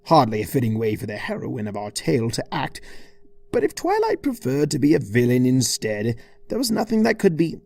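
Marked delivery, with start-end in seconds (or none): none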